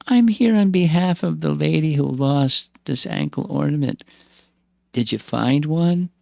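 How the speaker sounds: put-on high creaky old woman asking questions; creaky old lady voice. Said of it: "in a creaky voice".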